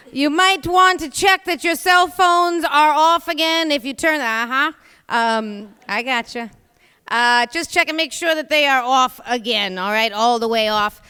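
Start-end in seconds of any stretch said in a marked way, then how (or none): none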